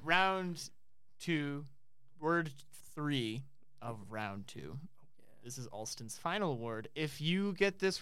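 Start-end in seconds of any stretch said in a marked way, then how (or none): 0.0-4.8 slow announcement. Shown as a demonstration. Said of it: "game host announcement".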